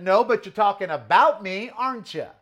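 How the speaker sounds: loud, high accusing challenge; retold challenge. To the guard on the phone, loud challenge. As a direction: push back hard at him. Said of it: "I said".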